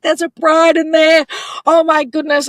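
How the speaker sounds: high, loud mock squeal of excitement; excited onlookers. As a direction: imitate bystanders calling out in delight.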